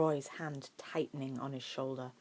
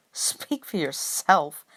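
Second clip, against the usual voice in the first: slow, haughty put-down; lofty; 10 dB louder, much slower than usual. A narrator's reading. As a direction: drag it out like you're above it all. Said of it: "Harry replied loftily".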